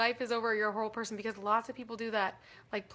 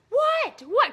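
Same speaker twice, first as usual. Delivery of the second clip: high, loud mock What; mock yell; 13 semitones higher, 9 dB louder than usual. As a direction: yell What back, hammed up. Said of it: "I'm like".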